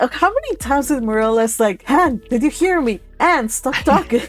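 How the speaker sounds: usual speaking voice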